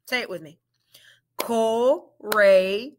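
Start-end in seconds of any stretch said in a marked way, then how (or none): 1.4-2.9 "Courageous" said slowly in parts; syllable by syllable; split into syllables. Shown as a demonstration. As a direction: segment "Courageous". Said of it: "Let's blend it".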